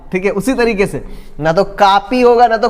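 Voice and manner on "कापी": "कॉपी" said as kaapi; wrong aa vowel. Shown as a demonstration. Teacher showing a mistake, "कॉपी" as kaapi. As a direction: open the first vowel of "कॉपी" to pure aa. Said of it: "दोनों गलत है".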